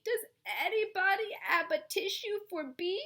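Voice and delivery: high, stuffy plea through a blocked nose; stuffed-up voice. Acted. The flea asks the barn for a tissue, stuffed-up. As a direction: plead as if all stopped up. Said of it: "He's all stopped up".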